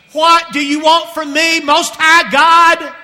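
slow, loud and high, the possessed man crying out; loud demonic cry. Acted. Possessed man crying out to Jesus, slow and loud. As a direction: bellow it out slowly like a tormented demon. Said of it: "with a loud voice".

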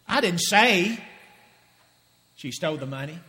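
"I didn't say" lifted and loud, then drop; stress on didn't say. Shown as a demonstration. Preacher demonstrating meanings, stress on didn't say. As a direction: push "didn't say" up, let the rest sink. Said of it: "Let me say it to you in different ways".

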